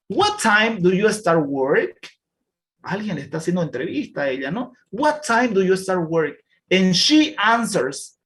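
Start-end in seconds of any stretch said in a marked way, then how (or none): 0.1-2.1 slow, clear reading of a class text; slow reading aloud. Shown as a demonstration. Teacher reading a text slowly to the class. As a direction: read each word slowly for learners.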